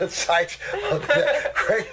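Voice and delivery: laughing it out, high. Real, not acted. Laughing at the quack site to his partner. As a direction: laughs through the words in disbelief.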